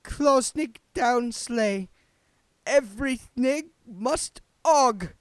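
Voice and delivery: slow, high, loud garbled sign read; high, slow reading of misprinted flakes. A narrator's reading. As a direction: spell out the mangled words slowly. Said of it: "make out the words".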